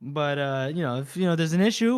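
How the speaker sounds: ordinary talk, nothing marked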